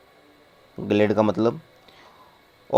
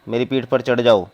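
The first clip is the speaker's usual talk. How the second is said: ordinary delivery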